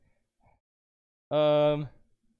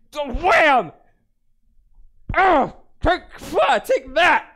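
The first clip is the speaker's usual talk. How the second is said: fight shouts; 4 semitones higher, 4 dB louder, slower than usual. Acted. Bar fight blows.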